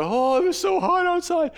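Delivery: high, put-upon martyr whine; martyr complaining. Retold or imitated. Preacher mocks a martyr for the congregation, high whiny complaint. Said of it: "Only if you're a martyr and go".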